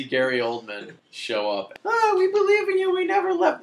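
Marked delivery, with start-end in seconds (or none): 1.8-3.6 much higher, louder airy ghost voices; mock ghostly parents. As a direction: coo like sappy dead parents.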